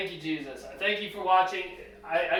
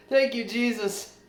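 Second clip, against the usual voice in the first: joyful thanks; 5 semitones higher, 4 dB louder than usual. Real, not acted. Grateful shout after relief.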